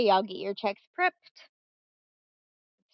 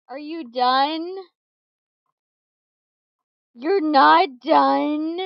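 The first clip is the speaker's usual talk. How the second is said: exasperated; 5 semitones higher, 8 dB louder, much slower than usual. Real, not acted. Scolding beeping device.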